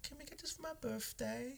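put-on soft, slow, high child voice; little kid. Kid asking dad about catering, soft high imitation. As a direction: imitate a small child's hopeful plea, high and quiet.

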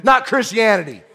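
high, loud pulpit delivery; impassioned preaching. Real, not acted. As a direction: preach it with building fervour. Preaches at full force.

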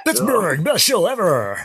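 high, hyped mock frontman. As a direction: ham up a cheesy band singer. Mocking the singer's crowd banter.

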